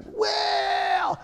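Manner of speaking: long, high-pitched drawn-out sing-out; mock sceptical. Preacher mimicking people who doubt scripture, mock sceptical.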